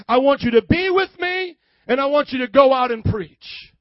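loud, emphatic charge; rousing commission. Acted. Jesus sending his apostles out to preach, loud rousing call.

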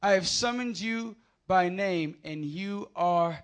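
slow, solemn scripture read. Acted. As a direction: read it slow and weighty, like a proclamation. Reads Isaiah 43 aloud.

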